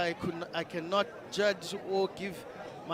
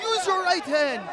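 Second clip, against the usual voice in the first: loud, high ringside instruction; shouting; 10 semitones higher, 5 dB louder than usual. Real, not acted. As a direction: yell the coaching tip.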